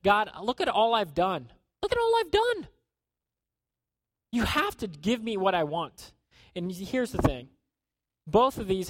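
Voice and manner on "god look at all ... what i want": demanding believer. Retold or imitated. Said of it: "It says".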